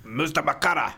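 mustamakara said harsh and clipped; mock angry Finnish. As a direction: spit out mustamakara with mock anger. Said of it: "Why do they say it so angrily".

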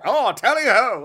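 loud high mock knight cry of tally-ho; knight's shout. Knight runs up to diners, hammed-up loud cry. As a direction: belt it like a charging knight.

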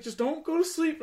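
high, loud, frantic father impression; panicked dad, imitated. Dad driving injured son to hospital, panicked. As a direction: pitch it way up, playing an alarmed parent.

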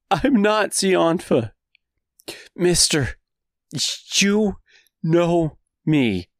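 high, slow desperate pleading; begging for my life. Acted. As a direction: beg him to recognize you. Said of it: "the same pleading voice".

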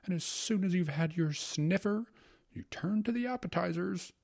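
high-pitched gourmand secretary; carried-away epicure in a high voice; rapturous secretary. Acted. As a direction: lilt upward with blissful relish. Secretary resumes his rapturous food speech.

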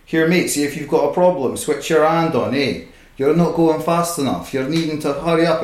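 mock a bossy East Coast Scottish foreman hurrying a worker; East Coast Scottish accent. Warehouse manager telling a packer to hurry, East Coast Scottish accent. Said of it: "the East Coast accents".

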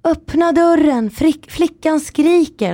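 high, drawn-out mock yell; retold scream. Grandmother banging on the hotel door, mock screaming. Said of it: "hon skrek liksom".

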